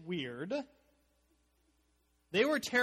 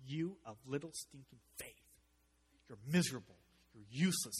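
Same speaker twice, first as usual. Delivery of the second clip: mock scornful rebuke; 2 semitones lower than usual. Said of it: "I don't think he's looking down going".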